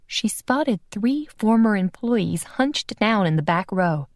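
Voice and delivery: ordinary delivery, nothing marked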